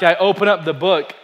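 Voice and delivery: ordinary talk, nothing marked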